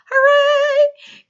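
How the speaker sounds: high, loud, drawn-out hooray; exaggerated teacher celebration; cheer. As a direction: cheer loudly for the class in a high voice. Cheering for finished work.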